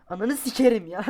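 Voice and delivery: high, loud cursing outburst; furious curse. Real, not acted. Gamer cursing the game after a fall, loud. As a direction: explode at the game.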